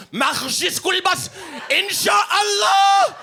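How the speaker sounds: put-on high, slow shouted Egyptian accent; overdone Egyptian accent, stretched into a wail; booming Egyptian accent, far too intense; thick Egyptian accent. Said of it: "his accent was too authentic".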